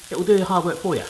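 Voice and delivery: ordinary talk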